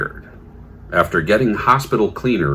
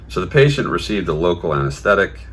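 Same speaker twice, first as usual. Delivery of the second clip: ordinary delivery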